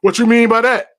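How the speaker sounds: sudden loud challenging question; defensive snap. Retold or imitated. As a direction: bark it back, offended. Acted hostile reply in quarrel.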